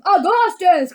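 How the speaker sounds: loud fatherly shout; crying out. A narrator's reading. Mr. Gloop crying to his son, loud. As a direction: shout the name forcefully. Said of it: "cried Mister Gloop".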